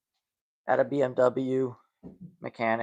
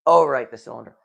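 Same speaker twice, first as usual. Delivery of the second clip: normal talking voice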